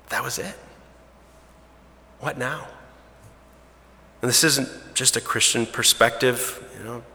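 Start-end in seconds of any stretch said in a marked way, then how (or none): 0.1-2.7 hushed disappointment. Acted. Quoting the anticlimax feeling.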